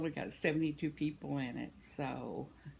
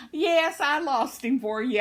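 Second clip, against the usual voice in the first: high, loud happy dog talking; dog's voice; 7 semitones higher, 9 dB louder than usual. Retold or imitated. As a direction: voice the proud dog bragging to its owner. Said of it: "wiggle his tail like".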